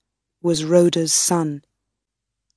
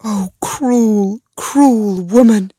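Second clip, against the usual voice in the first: anguished cry, higher and louder; 3 semitones higher, 4 dB louder, slower than usual. A narrator's reading. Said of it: "cried Rhoda".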